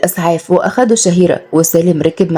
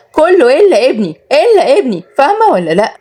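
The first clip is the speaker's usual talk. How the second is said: raised, higher voice hissing الا ابني; mother furious at son's servant, higher and raised; spiteful; 7 semitones higher than usual. A narrator's reading. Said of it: "وهي بتقول بغل". (صوت أم غاضبة بحقد وأعلى)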